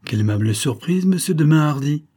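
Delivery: hearty exclamation rising high and loud on the name; exclaimed surprise. A narrator's reading. Magyar greeting the count unexpectedly met, exclaiming. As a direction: greet him with a lightly acted cry of surprise. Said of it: "s'écria celui-ci".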